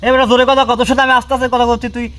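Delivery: loud, high scolding shout at the spirit; angry shout. Real, not acted. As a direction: bark the order so it must answer. (ভূত তাড়াতে গিয়ে রাগী চিৎকার)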